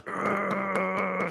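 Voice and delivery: long, high sustained yell at contained volume; warrior scream demo. Shown as a demonstration. Coach showing viewers how to release, screaming like a warrior. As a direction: hold a high warrior cry without full force. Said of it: "scream like a warrior".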